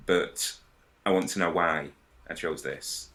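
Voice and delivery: usual speaking voice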